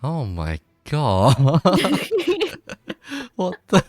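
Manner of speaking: loud, real disbelief breaking into high laughter; shocked laughter. Real, not acted. Listener hears the story's punchline, shocked and laughing loudly. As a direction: drag it out, then crack up.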